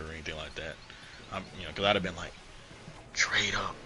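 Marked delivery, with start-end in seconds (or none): 3.1-3.8 hushed advice; whispered aside. Retold or imitated. Telling Peter to move on, whispered. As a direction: whisper it like a secret tip.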